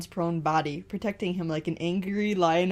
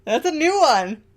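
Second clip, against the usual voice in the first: excited; 9 semitones higher, 8 dB louder than usual. Real, not acted. Amused at word garnet.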